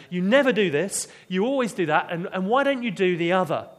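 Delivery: loud mock outburst at husband; wife snapping at her insensitive husband, loud mock outburst; flying off the handle. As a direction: shout the accusations like a row. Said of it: "we fly off the handle at them".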